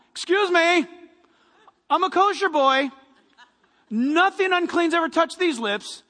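mock-pious outrage, proud of being kosher; self-righteous. Peter refusing God's unclean food, self-righteous. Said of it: "Very self-righteous about it".

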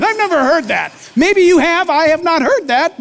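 ordinary delivery

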